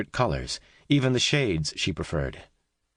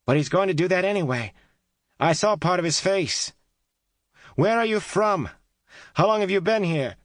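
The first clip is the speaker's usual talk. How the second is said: high, loud urgent shout; 13 semitones higher, 4 dB louder than usual. A narrator's reading. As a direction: keep shouting questions. Kate keeps shouting through wall.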